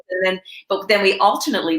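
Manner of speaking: normal talking voice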